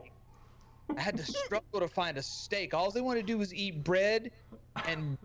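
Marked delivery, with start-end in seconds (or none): 0.0-1.0 softer, whispered word trailing off. Real, not acted. Trailing off while a cohost jokes, in a whispered fade. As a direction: let the word fade to breath. Trailing off under speaker's joke.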